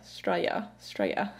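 Straya clipped to two syllables, whispered; Aussie slang Straya, whispered. Shown as a demonstration. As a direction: breathe out Straya, slurred short. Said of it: "a slang term for Australia".

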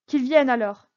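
quick, loud order; brisk command. A narrator's reading. Richelieu urgently summoning the count, loud brisk order. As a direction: snap it out eagerly. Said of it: "dit vivement Richelieu".

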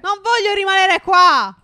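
high, loud, drawn-out refusal; protesting wail. Pretend. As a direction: protest at being left behind. Protests being left with the doctor. (protesta lamentosa ad alta voce)

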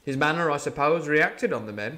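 ordinary delivery

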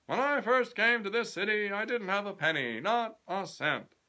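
a self-made boss lecturing a youth; grand old tycoon addressing a young man; pompous company president. Acted. President Kennedy voiced in the story.

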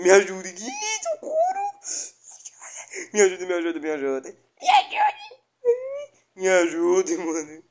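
panicked, high pleading cries; scared voice. Real, not acted. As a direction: beg for help in real fright. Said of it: "até minha voz tá meio, meio espantada".

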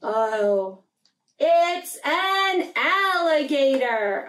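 ordinary delivery

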